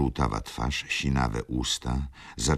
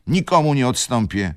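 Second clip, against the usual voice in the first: higher, louder resolute refusal; firm; 10 semitones higher, 8 dB louder than usual. Acted. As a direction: refuse flatly. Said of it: "odparła stanowczo".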